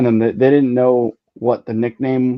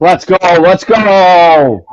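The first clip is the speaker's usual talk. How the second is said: excited cheer; 7 semitones higher, 7 dB louder, much slower than usual. Real, not acted. Cheering a booked bet.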